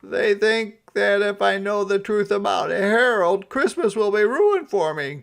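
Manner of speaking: loud, high distressed cry; high anxious outcry; cried out. Acted. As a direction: wail it out in dismay. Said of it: "cried Santa".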